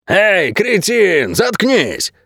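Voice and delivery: hollered insult from a distance; angry yell. A narrator's reading. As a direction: call out crossly, stretching the words. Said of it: "зло крикнул кто-то снаружи".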